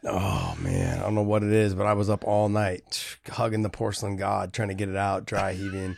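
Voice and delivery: low-energy sick voice; drained, barely getting words out; sick and weak. Real, not acted. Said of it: "low energy".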